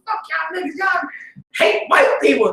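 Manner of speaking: high angry jeer; mock shouting fan; hostile crowd. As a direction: curse at them like a rioter. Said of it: "crowd turned against us".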